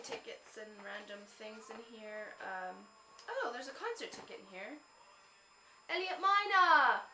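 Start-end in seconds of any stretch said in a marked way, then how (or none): 5.9-7.0 high and loud, slow and drawn out with delight; real thrilled cry at a band's name; excited. Real, not acted. Finding her old concert ticket, slow, loud excited cry.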